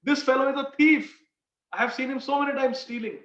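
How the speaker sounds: high-pitched, pleading mock wail; crying out in protest. Condemned merchant pleading with the king, crying out in imitation. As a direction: protest desperately, voice pushed high. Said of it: "the merchant starts crying".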